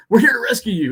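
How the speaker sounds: high, loud, urgent proclamation; mock rescue announcement. Teammate runs up to the hooded prisoner, mock heroic urgency. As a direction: play an eager rescuer bursting in. Voicing rescuer running up to captive.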